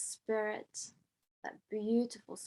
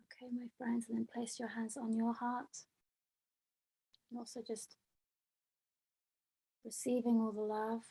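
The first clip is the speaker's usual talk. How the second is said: much softer meditation guidance; guided meditation; 6 dB quieter than usual. Acted. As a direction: gently lead them inward, voice sinking away. Guides silent heart meditation.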